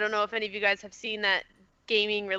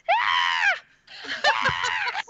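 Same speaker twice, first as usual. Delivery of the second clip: high-pitched imitated shriek; mock girly scream; 5 dB louder than usual. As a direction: shriek high like a scared guy at a horror movie. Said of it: "screaming like a girl".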